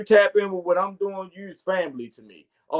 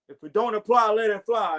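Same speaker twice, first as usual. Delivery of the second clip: ordinary talk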